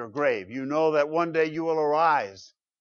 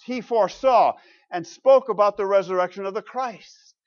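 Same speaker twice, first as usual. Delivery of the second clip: raised, punched preacher's delivery; emphatic preaching; 4 semitones higher, 6 dB louder than usual. Real, not acted. Preacher declaring what the prophet David foresaw, emphatic preaching. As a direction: drive the key words home to the congregation.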